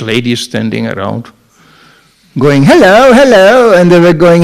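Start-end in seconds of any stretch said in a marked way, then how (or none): 2.6-3.7 high, loud sing-out hello; imitated come-on, pitched up; flirty street greeting. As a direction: call out like a streetwalker luring passers-by. Voicing ladies in a side street.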